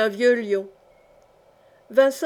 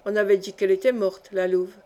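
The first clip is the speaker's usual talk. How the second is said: ordinary delivery, nothing marked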